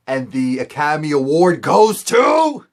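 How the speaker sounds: hammed-up award-show shout; mock awards announcer. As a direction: present a trophy with sarcastic fanfare. Mock award announcement at Lionel's act.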